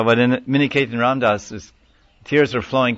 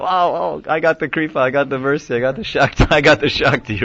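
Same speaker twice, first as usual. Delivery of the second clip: higher, hammed-up joy, half laughing; mock ecstatic disciple; 5 semitones higher than usual. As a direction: play the giddy disciple who just got the mercy. Disciple jumping around after blessing.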